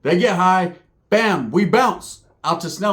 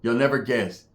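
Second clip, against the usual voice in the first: normal talking voice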